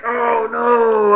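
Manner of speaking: high, loud mock wail of dread; stressed out. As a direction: wail it out in exaggerated panic. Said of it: "I was so stressed out".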